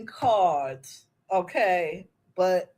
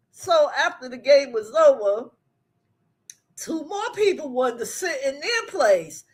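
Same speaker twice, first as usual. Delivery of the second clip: ordinary talk, nothing marked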